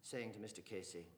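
usual speaking voice

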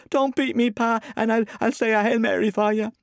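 put-on very high, loud child's pleading; terrified little boy wailing; shaking with fright. A narrator's reading. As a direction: beg frantically not to be beaten. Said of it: "his voice shook with fright".

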